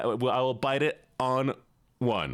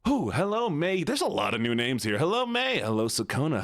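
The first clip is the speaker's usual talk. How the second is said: ordinary delivery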